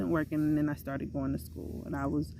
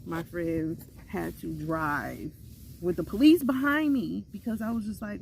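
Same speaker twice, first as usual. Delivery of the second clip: normal talking voice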